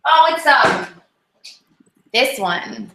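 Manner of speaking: usual speaking voice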